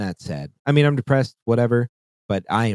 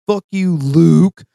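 higher, louder and slow mocking snarl; mock toxic gamer; 7 semitones higher, 7 dB louder, slower than usual. Player answering his good game, slow mock snarl. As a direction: drag it out like a sore loser spitting back.

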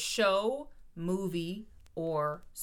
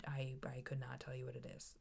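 whispering; 5 semitones lower, 14 dB quieter than usual. Real, not acted. Whispered aside about music.

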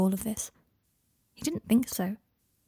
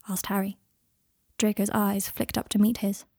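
usual speaking voice

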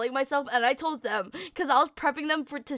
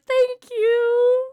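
high, louder, voice breaking; teary thanks; 11 semitones higher, 6 dB louder than usual. Retold or imitated. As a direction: thank her on the edge of tears. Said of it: "And I started crying".

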